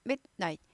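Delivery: midnight cut in two, mit and night; crisp break after mid in midnight; staccato midnight. Shown as a demonstration. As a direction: stop the breath briefly inside midnight. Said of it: "이거 스타카토 있어야 돼요".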